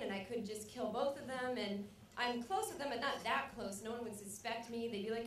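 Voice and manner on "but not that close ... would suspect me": ordinary talk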